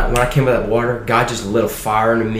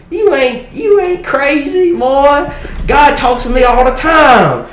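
much higher, louder hammed-up good ol' boy voice; Southern Baptist old boy; 8 dB louder, slower than usual. Dean of students reassuring the scared kid, hammed-up high Southern voice. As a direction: play the hearty Southern dean, pitched way up. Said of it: "a good Southern Baptist old boy".

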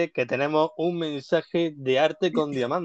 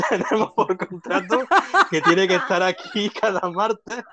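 laughing; 6 semitones higher than usual. Real, not acted. Laughing while quoting herself.

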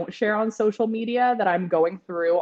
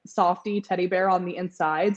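ordinary talk, nothing marked

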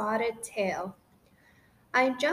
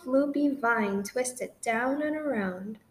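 plain reading voice

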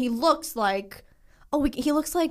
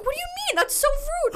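much higher squeaky offended voice; mock offended kid. Gym desk kid protesting to her, squeaky offended. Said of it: "And he was like".

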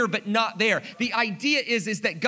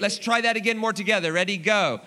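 ordinary talk

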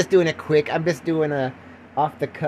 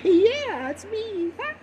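high, giddy pretend-star voice; coy celebrity; much slower than usual. Film star recognised by a fan, hammed-up coyness. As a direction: squeal shyly, softening at the end.

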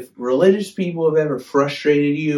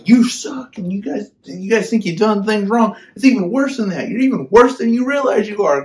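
higher and louder fiery preacher scolding; fiery rebuke; 7 semitones higher, 5 dB louder than usual. Retold or imitated. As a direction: blast them like a fierce prophet. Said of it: "he's just telling everybody".

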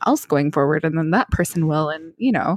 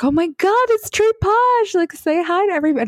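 excited fan squeal; 13 semitones higher than usual. Retold or imitated. Old school friend spotting her at the restaurant, high excited squeal. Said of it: "And he was like".

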